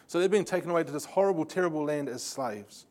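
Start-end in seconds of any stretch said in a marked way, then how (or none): none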